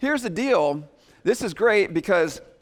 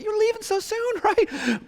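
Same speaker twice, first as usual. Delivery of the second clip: put-on much higher, sweet old grandma; grandmother's voice. Grandmother protesting a grandchild leaving, high sweet voice. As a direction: plead with a departing grandchild, high. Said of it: "she's like".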